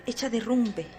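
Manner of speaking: ordinary delivery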